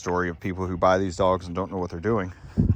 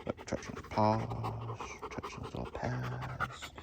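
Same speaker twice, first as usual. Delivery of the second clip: hushed; 3 semitones higher, 10 dB quieter than usual. Real, not acted. Soft murmur while touching paws.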